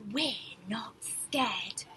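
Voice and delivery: slow breathy whisper, pretending to be brave. A narrator's reading. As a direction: whisper it slowly, not scared at all. Refrain whispered in storytelling.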